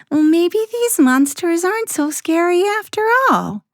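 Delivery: put-on high, relieved little girl. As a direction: voice Sarah as a relieved child. Sarah voiced in story.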